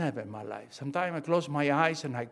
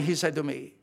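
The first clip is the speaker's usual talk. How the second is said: ordinary delivery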